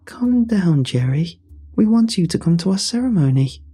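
high-pitched tearful plea, lightly acted; weeping. A narrator's reading. Lisa begging the ranger to come to the ceremony, tearful. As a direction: plead as if weeping, pitched well up. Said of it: "she wept".

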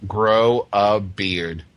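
slow, deliberate three words; slow deadpan. Shown as a demonstration. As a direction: drag it out for effect, slowly. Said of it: "three-word answer here".

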